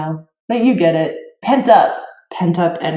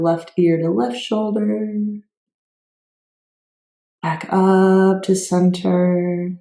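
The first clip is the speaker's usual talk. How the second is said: guided yoga cueing; 5 dB quieter than usual. Real, not acted. Teacher guiding students through neck stretches, soft yoga cueing. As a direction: softly lead a stretch, leaving room for breath.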